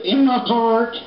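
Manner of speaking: put-on high, sweet child's answer; a hint of a little kid chirping. Preacher voicing a nursery kid answering where Jesus lives, high childlike. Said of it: "Go ask a little kid".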